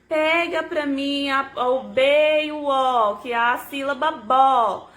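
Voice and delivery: slow, drawn-out syllables for a learner; measured, deliberate. Shown as a demonstration. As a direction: guide a child slowly, stretching each letter. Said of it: "falando pra ela compassadamente".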